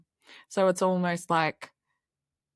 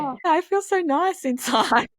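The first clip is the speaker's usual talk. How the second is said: much higher and louder, slow and dreamy with a laugh; mock blissful; 11 semitones higher, 4 dB louder than usual. Guest joking about feeling soothed, high and giggling. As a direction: swoon playfully as if soothed. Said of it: "I'm like".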